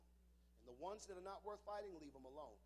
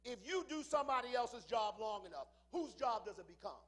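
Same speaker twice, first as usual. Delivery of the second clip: much louder, hammering the question; shouted repeat; 7 semitones higher, 14 dB louder than usual. Shown as a demonstration. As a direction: shout it back at the crowd. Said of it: "repeats it louder".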